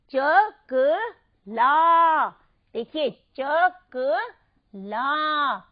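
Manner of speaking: चकला in slow separate syllables, stretching ला; emphatic long आ. Shown as a demonstration. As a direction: hit and hold the ला ending of चकला. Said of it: "हमने इसको जोर से उच्चारित किया".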